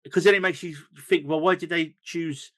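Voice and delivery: ordinary talk, nothing marked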